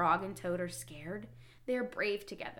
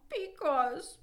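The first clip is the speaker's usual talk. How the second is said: high mock wail; storybook wail, pitched up; 9 semitones higher, 4 dB louder than usual. A narrator's reading. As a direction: whine it as a distressed Toad. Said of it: "wailed To".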